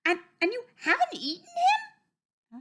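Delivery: very high, loud, incredulous duck; squeaky disbelieving duck; Mrs. Duck asking. A narrator's reading. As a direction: stammer the question in a high duck voice. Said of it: "asked Missus Duck".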